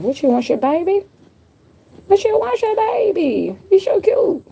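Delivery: high, sing-song cooing at a pet. Real, not acted. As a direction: coo up high like talking to a kitten. Cooing at her cat.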